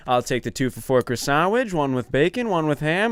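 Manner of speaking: lilting recital, pitch climbing item by item; scripted order. Retold or imitated. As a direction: reel off a memorized order, mocking the routine. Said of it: "read it off like it's a script".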